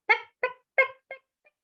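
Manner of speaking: peck like the turkey; pecking sounds. A narrator's reading. Narrator mimics the turkey eating, high pecking sounds.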